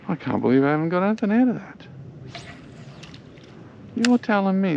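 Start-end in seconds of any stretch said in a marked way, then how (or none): none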